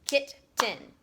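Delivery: "Kitten" as two separate chunks, kit and ten; split into syllables. Shown as a demonstration. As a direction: pause between the halves of "Kitten". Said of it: "It has kit and it has tin".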